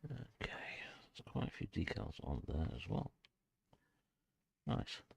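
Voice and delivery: whispered to himself; whispering. Real, not acted. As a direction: whisper it to yourself. Talking quietly to himself.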